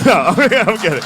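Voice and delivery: high laughing confession. Real, not acted. Comic confessing to the crowd he's joking, laughing. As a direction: laugh it out, I'm kidding.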